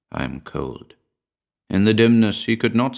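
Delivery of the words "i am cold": soft, faint and hollow; faint ghostly voice. A narrator's reading. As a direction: say it like a fading ghost. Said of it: "Her shadow voice".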